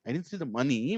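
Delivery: "money" lifted and stretched at the end; stress on money. Shown as a demonstration. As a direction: run through to a rising, emphasized "money". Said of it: "I put a stress on money".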